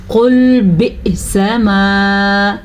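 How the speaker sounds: slow melodic tajweed recitation; Quran recitation. Shown as a demonstration. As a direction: draw the vowels out like a Quran reciter. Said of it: "teacher recites the verse to teach".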